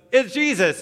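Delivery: high, loud, sudden outburst; impulsive cry. Acted. Peter spots Jesus and abandons the others, excited blurt. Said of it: "Peter just goes".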